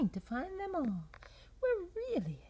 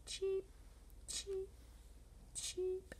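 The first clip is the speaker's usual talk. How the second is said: high little chick cheeps; 6 semitones higher, 9 dB quieter, slower than usual. Acted. Reader voicing chicks on the egg hunt, high cheeping. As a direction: peep like a tiny chick. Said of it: "That's what chicks say".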